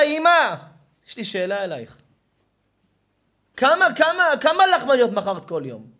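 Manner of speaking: high, full-force demanding question; impassioned preacher's cry; urgent shouting. Acted. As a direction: call out to your mother with burning urgency. Preacher acts son's urgent question.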